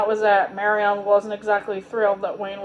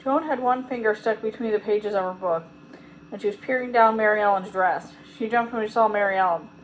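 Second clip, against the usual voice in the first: ordinary delivery, nothing marked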